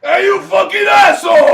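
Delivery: loud, high furious yell; angry yell. Acted.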